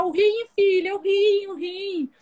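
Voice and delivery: imitating a weeping father; father crying. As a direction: sob it out with joy. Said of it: "começou a chorar".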